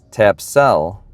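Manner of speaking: ordinary delivery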